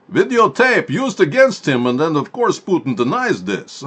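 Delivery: mock Russian accent. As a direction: lay out the scheme like a cartoon Russian spy. Mock Russian villain explains plot.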